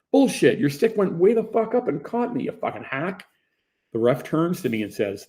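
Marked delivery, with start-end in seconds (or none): 0.1-3.3 high, loud furious yelling; angry yell. Retold or imitated. Player yells at opponent over the stick, furious. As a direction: tear into him. Heated quarrel on the rink.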